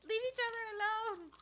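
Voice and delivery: mom yelling. Retold or imitated. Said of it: "It's like me yelling at my kids".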